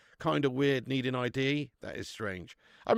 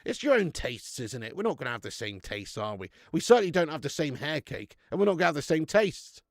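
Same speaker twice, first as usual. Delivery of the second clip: normal talking voice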